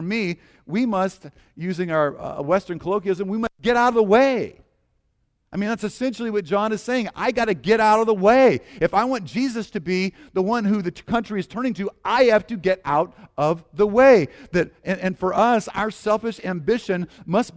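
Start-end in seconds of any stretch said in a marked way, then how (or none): 3.3-4.6 louder, pressing the point; emphatic exhortation. Real, not acted. Preacher urging the congregation, louder. 7.2-8.6 louder, pressing the point; emphatic exhortation. Real, not acted. Preacher urging the congregation, louder. 12.0-14.3 louder, pressing the point; emphatic exhortation. Real, not acted. Preacher urging the congregation, louder.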